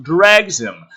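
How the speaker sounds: ordinary talk, nothing marked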